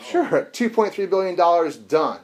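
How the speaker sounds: mock breezy agreement. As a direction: toss it off loudly like it's pocket change. Bank mocked accepting fine.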